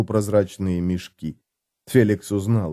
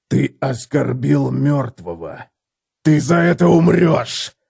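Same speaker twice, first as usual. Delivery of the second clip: deadly threat; 3 semitones higher, slower than usual. A narrator's reading. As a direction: promise his death slowly. Gotrek threatening death. (Готрек медленно и грозно угрожает Феликсу)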